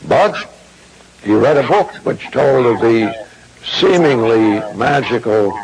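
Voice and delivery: normal talking voice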